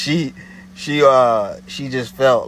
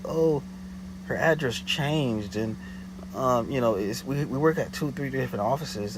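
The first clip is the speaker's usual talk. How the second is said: mumble; 3 semitones higher, 7 dB quieter than usual. Retold or imitated. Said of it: "the little mumble in the back".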